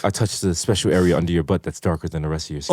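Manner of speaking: soft, low seductive voice; seductive romance narration. Acted. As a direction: read it like erotic fanfic.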